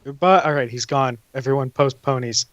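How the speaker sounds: normal talking voice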